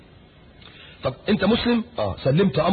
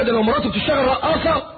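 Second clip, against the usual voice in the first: shouted exclamation; 8 semitones higher than usual. Real, not acted. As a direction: shout it out in disbelief.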